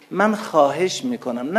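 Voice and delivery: ordinary talk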